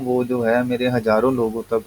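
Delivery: ordinary delivery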